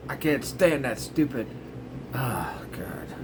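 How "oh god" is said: whispered, fed-up groan; under-the-breath oh God; exasperated whisper. Real, not acted. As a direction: breathe it out in disgust. Annoyed aside.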